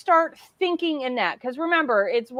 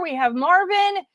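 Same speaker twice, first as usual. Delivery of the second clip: normal talking voice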